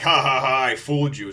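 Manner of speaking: mock villain gloating. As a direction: imitate a pirate crowing over his trick. Imitates villain gloating over his trick.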